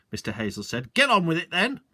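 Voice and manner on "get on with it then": higher, louder impatient command; impatient landowner. Acted. Landowner impatiently ordering the sergeant on, higher and louder. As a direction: hurry them up, pompously.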